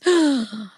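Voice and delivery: loud, sudden gasp; big audible intake of breath. Real, not acted. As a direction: gasp sharply in surprise. Reacting to a chat message.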